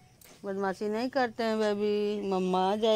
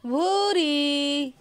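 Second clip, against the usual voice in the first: a long, slow, higher call of the name; drawn-out call; 6 semitones higher, much slower than usual. Shown as a demonstration. Owner shows how the puppy reacts to the call, drawn-out higher call. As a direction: show how you call her, stretching the name. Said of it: "देखिए भूरी बोलूँगी तो कैसे देखेगी".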